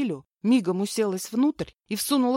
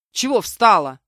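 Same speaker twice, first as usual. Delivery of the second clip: loud, impatient bark; snapped shout; 3 semitones higher, 11 dB louder than usual. A narrator's reading. Kidnapper yelling at the stalled woman, snapped shout. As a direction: shout at her to get moving. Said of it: "прикрикнул на меня Степан".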